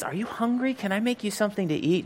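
eager kind host. Retold or imitated. Martin eagerly offers food.

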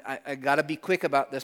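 usual speaking voice

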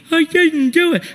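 high mock-tearful protest; crying child. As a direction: imitate a spanked boy wailing his denial.

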